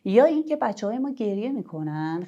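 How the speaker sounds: usual speaking voice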